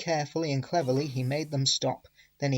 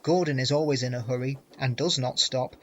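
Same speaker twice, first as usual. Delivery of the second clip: ordinary delivery, nothing marked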